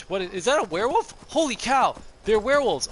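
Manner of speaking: sudden loud frightened yelling; scared shout. Real, not acted. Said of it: "That was, that was a little scary".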